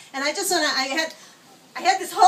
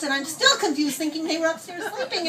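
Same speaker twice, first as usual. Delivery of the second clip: ordinary talk, nothing marked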